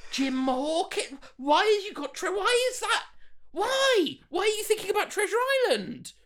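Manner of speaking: very high, squeaky mock bafflement; incredulous outburst. Podcaster ridiculing an absurd script line, incredulous outburst. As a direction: shriek it in comic disbelief, rushing the last question.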